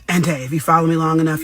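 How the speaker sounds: usual speaking voice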